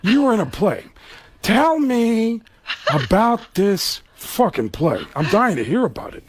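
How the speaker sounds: slow, menacing Pacino drawl; a gravel-edged Al Pacino, unhurried; Pacino impression. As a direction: lean in and let the contempt build. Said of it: "And he goes".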